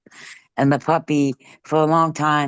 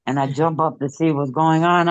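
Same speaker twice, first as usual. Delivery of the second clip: usual speaking voice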